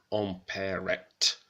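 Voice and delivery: empirette drawn out slowly, syllable by syllable; Old French word. Shown as a demonstration. Reading a manuscript line, empirette sounded out slowly. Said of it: "the final T in the past tense".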